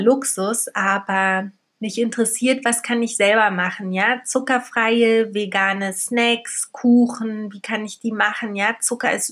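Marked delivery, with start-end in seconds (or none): none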